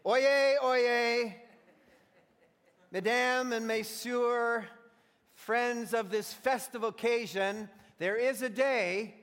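loud, high herald's proclamation; town crier. Acted. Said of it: "if I were a town crier".

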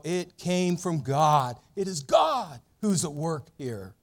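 normal talking voice

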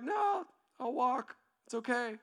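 high, slow, reluctant decline; awkward polite refusal. Acted. Friend avoiding stained car, high hesitant polite refusal. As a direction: stretch the no and back out gently.

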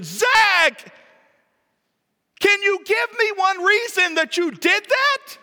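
high, very loud parental outburst; exasperated shout. Acted. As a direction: yell at your son in total disbelief. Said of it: "gasped in ex-, e-, e-, exasperation".

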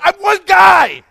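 high, loud exasperated shout; frustrated shout. Retold or imitated. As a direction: blurt it out at full volume, fed up. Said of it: "and I'm getting frustrated".

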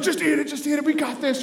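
high, excited urging; retold dad cheering his kid on; urgent coaxing.

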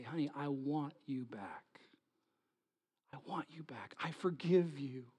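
soft whispered forgiveness; tender whisper. Acted. Husband tenderly forgiving wife.

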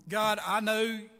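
showing the congregation how he prays; confession prayer. Shown as a demonstration. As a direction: pray it out like you mean it. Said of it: "I do this now".

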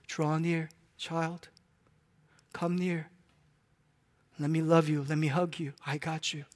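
soft, tender voice with pauses; gentle. Acted. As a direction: coax a child close, softly and reassuringly. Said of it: "wasn't that voice so gentle like that?".

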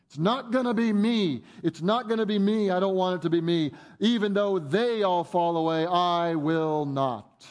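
acted-out stubborn vow; resolved protest. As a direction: repeat the denial with mounting insistence, then stretch the final refusal. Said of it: "it's like Peter resolved to not be the one".

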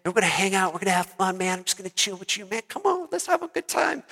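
soft, laid-back dude voice; cool, chill guy. Retold or imitated. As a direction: play a super-mellow babysitter, hushed and easygoing. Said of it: "He's really chill".

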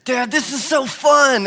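light imitation of a thrilled boy; excited little son. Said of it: "and he goes".

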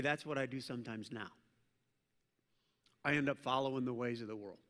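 usual speaking voice

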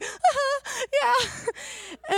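very high, loud squeal of agreement; overwhelmed agreement. Retold or imitated. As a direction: squeal it, overcome with excitement. Her own overwhelmed reaction, re-enacted.

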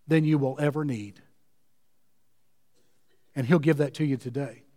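low, hushed preaching with long pauses; tender pastoral invitation. Real, not acted. Preacher inviting the weary to rest, tender appeal. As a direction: settle gently into the promise, letting phrases breathe.

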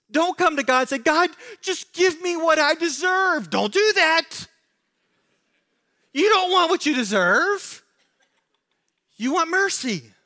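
high, loud, impassioned preaching; preaching at full force. Real, not acted. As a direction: thunder it at the congregation. Preacher exhorts congregation loudly.

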